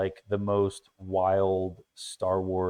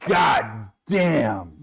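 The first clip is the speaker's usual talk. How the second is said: shout; 4 dB louder than usual. Real, not acted. Reacting to smelling salts.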